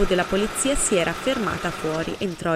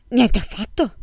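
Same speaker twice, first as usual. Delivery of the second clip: a touch louder and higher, offended; indignant; 4 semitones higher, 5 dB louder than usual. A narrator's reading. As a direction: snap back in mild indignation. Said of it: "disse indignata".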